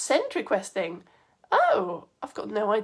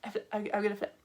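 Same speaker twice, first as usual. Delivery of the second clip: quiet repeat; 2 semitones lower, 8 dB quieter, faster than usual. Real, not acted. Teacher repeating her flip warning to viewers, quiet and halting. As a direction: say it under your breath, stumbling slightly.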